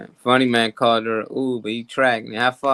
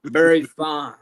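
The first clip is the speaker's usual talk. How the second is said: imitating [name] live; 3 semitones higher, 4 dB louder than usual. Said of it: "So you be like".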